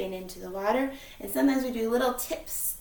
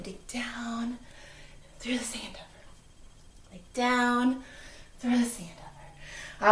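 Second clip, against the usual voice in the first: whispering; 5 dB quieter, slower than usual. Pretend. Whispered digging chant.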